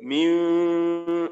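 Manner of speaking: long drawn-out meem with lips barely closed; held nasal meem. Shown as a demonstration. Teacher demonstrating iqlab to students, a long nasal meem. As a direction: hum the meem long through the nose. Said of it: "এখানে উচ্চারণটা হবে".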